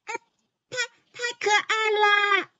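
high giggly voice; giggling spider. A narrator's reading. 红背蜘蛛咯咯笑. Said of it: "咯咯地笑着说".

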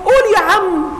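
normal talking voice

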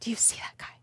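barely voiced, awed whisper; hushed disbelief; stunned whisper. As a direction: whisper it in acted shock. Stunned disciples spot Jesus.

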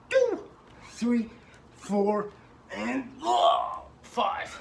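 loud, straining count near failure; doing push ups. Real, not acted. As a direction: force out each number.

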